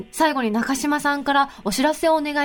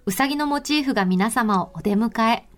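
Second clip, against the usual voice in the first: sponsor ad read; 3 semitones lower than usual. Acted. Host reading a resort notice to listeners, measured ad read. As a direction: read the resort spot in unhurried phrases.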